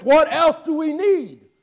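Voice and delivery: outraged cry. Retold or imitated. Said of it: "he says".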